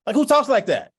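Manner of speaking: incredulous. Real, not acted. Speaker mocks formal talk to the audience, loud and incredulous. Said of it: "exclaims in disbelief".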